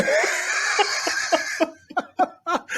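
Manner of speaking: high breathless laughter; breathy laugh. Real, not acted. Laughing at own joke.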